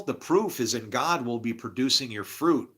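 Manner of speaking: ordinary talk, nothing marked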